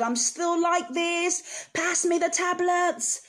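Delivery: high, slow, strained words of despair; desperate plea. Retold or imitated. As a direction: plead as if at breaking point. Begging husband for pills.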